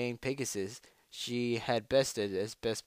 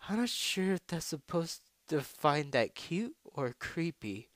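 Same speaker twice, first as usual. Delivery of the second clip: higher-pitched, hesitant doubt; unsure whether to be put off; puzzled, tentative character voice; uncertain; 5 semitones higher, 3 dB quieter than usual. A narrator's reading. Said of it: "said the Trixie uncertainly".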